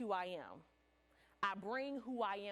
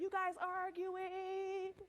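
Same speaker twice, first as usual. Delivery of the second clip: slow suspicious question; 7 semitones higher, much slower than usual. Retold or imitated. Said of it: "somebody came out".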